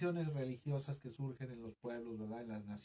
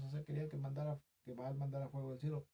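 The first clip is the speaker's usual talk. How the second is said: ordinary talk, nothing marked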